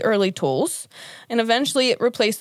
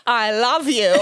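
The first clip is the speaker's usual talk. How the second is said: hammed-up celebrity impression; Tim Curry. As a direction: overplay it, on the edge of laughing. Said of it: "give me your best Tim Curry".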